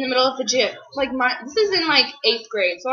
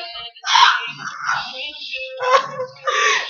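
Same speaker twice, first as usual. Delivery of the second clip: high, drawn-out fake sob; hammed-up sobbing squeal; mock crying; 12 semitones higher than usual. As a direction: wail wordlessly, imitating a crying fit. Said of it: "and I was like".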